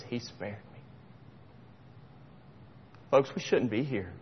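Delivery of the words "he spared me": barely voiced, much softer; emotional whisper. Real, not acted. Preacher overcome before his church, near whisper. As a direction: breathe it out, overcome. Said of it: "I'm kinda getting emotional".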